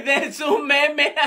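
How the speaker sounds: words squeezed through laughter; laughing. Acted. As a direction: answer while cracking up, drawn out. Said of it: "de qué tanto se están riendo". (hablando entre risas)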